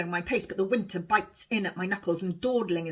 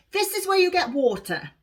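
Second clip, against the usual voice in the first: a higher, brisk guiding line; Alice explaining; 10 semitones higher, 4 dB louder than usual. A narrator's reading. Alice shows Honesty where to get water. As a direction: point it out to the newcomer.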